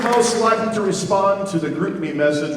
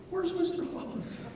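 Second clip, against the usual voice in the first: a hint of a sad little girl, pitched higher; 11 semitones higher, 15 dB quieter than usual. Band director imitating his sad flute student's question. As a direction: lightly voice the sad fifth grader asking. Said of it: "just so sad".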